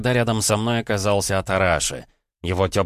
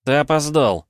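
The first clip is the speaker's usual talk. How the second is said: smug; 8 semitones higher, 5 dB louder than usual. Acted. Smug reply to the god.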